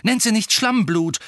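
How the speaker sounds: high, loud indignant rebuke; very angry. A narrator's reading. Ron and Ginny defending Hermine from an insult, very angry. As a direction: snap it out angrily, pitched high. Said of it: "gleichzeitig und sehr zornig".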